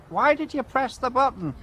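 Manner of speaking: squeaky kid asking, imitating children; kids' voice. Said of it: "all these kids coming up to me saying".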